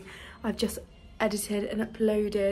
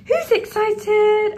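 much higher, louder, slow drawn-out "Who's excited?" with "Who's" stretched; excited pet talk; 11 semitones higher, 11 dB louder, much slower than usual. Real, not acted. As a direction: stretch out "Who's excited?" way up high to wind up the dog. Hyping up the dog.

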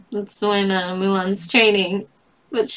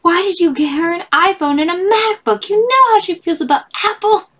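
higher, louder mock scolding; mock indignant; 10 semitones higher, 5 dB louder than usual. A friend scolding the gift giver over Apple, mock indignant. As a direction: deliver the pun as a scandalized friend.

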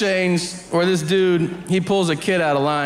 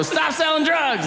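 mock shout; 11 semitones higher than usual. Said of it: "I'll go".

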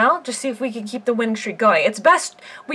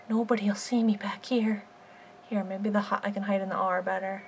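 hushed hiding voice; 2 semitones lower, 9 dB quieter than usual. Pretend. Giraffe hides among the letters, hushed near-whisper. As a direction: keep it secret, barely voiced.

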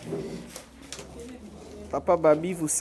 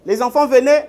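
calling out; 5 semitones higher, 6 dB louder than usual. Real, not acted. Host calls children over.

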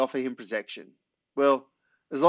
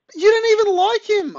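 high, loud indignant cry; outraged protest; 10 dB louder than usual. A narrator's reading. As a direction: shout your disbelief at him. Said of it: "I say".

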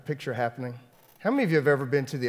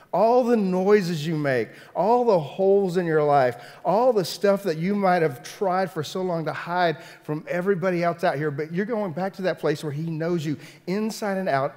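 louder, higher preacher's build; impassioned preaching; 5 semitones higher, 6 dB louder than usual. Real, not acted. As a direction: drive each phrase home to the congregation. Preaching at full force.

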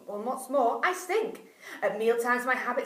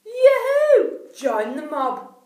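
high, loud yahoo, slow join the mob; joyful shout; 7 semitones higher, 11 dB louder, much slower than usual. A narrator's reading. Four animals welcoming the stork, loud cheer. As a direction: whoop in welcome. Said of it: "the other four shouted".

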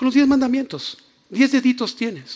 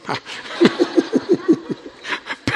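high breathy laugh; 9 semitones higher than usual. Real, not acted. Laughs at own teasing.

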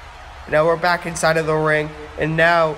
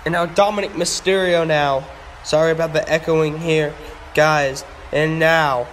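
ordinary talk, nothing marked

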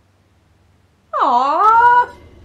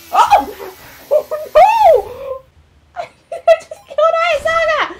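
much higher, loud dismay; shocked; 12 semitones higher, 5 dB louder, slower than usual. Real, not acted. Gamer shrieks after accidentally killing a character. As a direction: shriek at the mistake.

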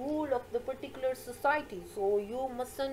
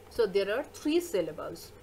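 ordinary delivery

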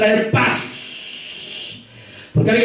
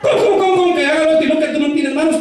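high-pitched mock outrage; indignant spouse; 4 semitones higher, faster than usual. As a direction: snap back in a raised, affronted pitch. Acts out a marital quarrel.

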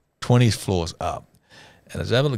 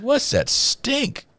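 much higher and very slow, nose wrinkled; mock disgusted question; much slower than usual. Teller mimicking the stink question, high and slow. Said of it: "I said".

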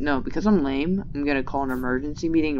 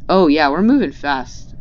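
loud excited cheer; excited shout; 2 semitones higher, 9 dB louder than usual. Real, not acted. Excited cheer, repeated calmer.

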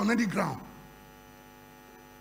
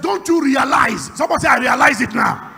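full-force preaching; 3 semitones higher, 11 dB louder than usual. Real, not acted. Preacher asking who lives inside them, booming call-and-response. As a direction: proclaim each phrase loudly to rouse the congregation.

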